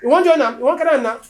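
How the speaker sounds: mock angry taunt.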